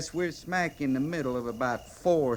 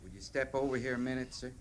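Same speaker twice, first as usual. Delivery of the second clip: hushed aside; 8 dB quieter, faster than usual. Acted. Sergeant pulls captain aside privately.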